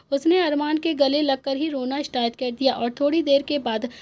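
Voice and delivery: plain reading voice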